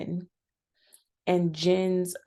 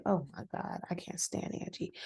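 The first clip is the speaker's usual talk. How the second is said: muttering; 10 dB quieter than usual. Retold or imitated. Inner reaction to Angie.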